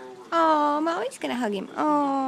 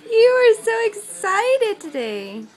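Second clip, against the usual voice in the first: excited, loud, higher; 6 semitones higher, 9 dB louder, slower than usual. Real, not acted. Mother delighted by toddler.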